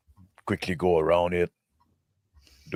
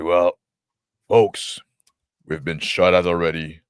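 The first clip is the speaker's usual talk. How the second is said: raised commanding voice; brief the squad loudly; addressing the troops; 2 semitones higher, 9 dB louder than usual. Acted.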